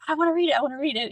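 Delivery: quick, eager little plea, words tumbling out; eager kid. Retold or imitated. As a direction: rush it like a child begging to see something. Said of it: "he was like".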